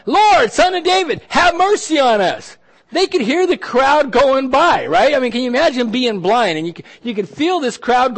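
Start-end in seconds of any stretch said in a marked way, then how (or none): 0.1-2.6 high, insistent shouted plea; crying out louder. Acted. Hushed blind men calling Jesus all the more, crying out. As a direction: shout past the people hushing you. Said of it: "They cried out all the more".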